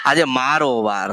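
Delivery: low, slow proclamation as the goddess. Acted. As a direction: drop the voice and declare it is her day. Medium speaks as goddess Meldi. (દેવીનો નીચો, ધીમો અવાજ)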